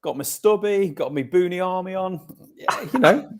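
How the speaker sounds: high hammed-up Australian accent; mock Australian accent. Guest describing his Aussie costume, mock Australian accent.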